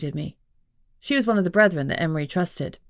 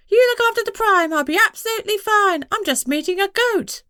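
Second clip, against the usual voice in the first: much higher, louder mocking falsetto; 4 dB louder than usual. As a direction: mimic her in a squeaky put-on falsetto. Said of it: "then assumed a falsetto voice".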